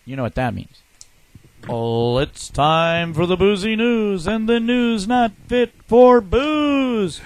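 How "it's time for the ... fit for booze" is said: announcer voice. Acted. Announcing the show's news segment.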